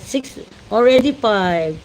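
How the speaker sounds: ordinary talk, nothing marked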